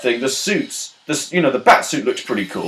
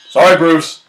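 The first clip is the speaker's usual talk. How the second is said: loud, higher acted call-out; raised voice in a pretend oops; mock apology; 5 semitones higher, 7 dB louder than usual. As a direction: toss out a big hammed-up sorry. Acts out Superman's careless apology.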